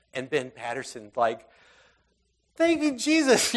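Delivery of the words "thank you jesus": high, loud burst of relief; relieved thanks. Retold or imitated. Father relieved by scripture, a high loud thank you. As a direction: cry it out in sudden relief.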